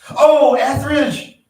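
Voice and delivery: loud, high, drawn-out hail; calling out. A narrator's reading. Visitor hailing Etheridge as he lumbers past, loud call. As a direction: sing out the name across the room, long and high.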